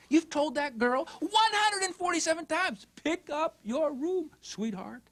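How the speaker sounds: high-pitched fed-up parent, lightly acted; exasperated parent. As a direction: act a parent at wits' end. Exasperated parent voiced in example.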